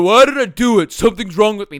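a hint of hammed-up despair; mock self-blame. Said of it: "But you might go".

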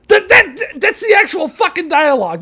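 high, loud disbelief; can't believe it's real; incredulous outburst. Real, not acted. Outraged at the script.

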